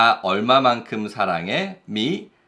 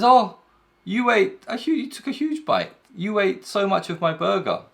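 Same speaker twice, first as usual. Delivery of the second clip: much higher, shocked mock complaint; indignant friend; 10 semitones higher than usual. Friend complaining about the huge burger bite, mock-outraged. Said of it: "and then he says".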